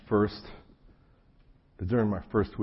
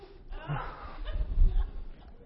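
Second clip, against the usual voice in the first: laughing sigh. Acted. Mock exasperated sigh after punchline.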